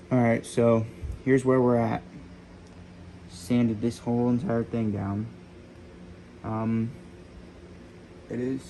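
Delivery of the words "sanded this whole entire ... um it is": muffled by a dust mask; through mask. Real, not acted. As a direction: talk through a sanding mask. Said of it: "sorry, I got a mask on my face".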